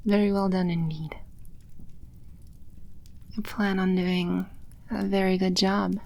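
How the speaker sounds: normal talking voice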